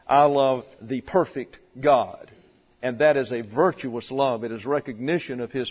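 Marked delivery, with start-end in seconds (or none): 0.1-2.3 slow build to a separated, falling "God"; stress on GOD. Shown as a demonstration. Preacher puts emphasis on the object, stress on God. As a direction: set "God" apart and land on it. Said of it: "the emphasis on the object".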